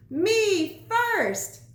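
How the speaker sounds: high, loud eager cry; cried out. A narrator's reading. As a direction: call it out racing ahead. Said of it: "cried Pinkerton".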